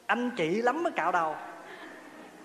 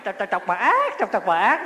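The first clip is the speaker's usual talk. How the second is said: clucked syllables ending in a loud squawk; staccato clucking, final word jumping up; cursing chicken; 5 dB louder than usual. Retold or imitated. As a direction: mimic a chased hen grumbling insults. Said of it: "mà cái chửi miết như vậy tới già thôi".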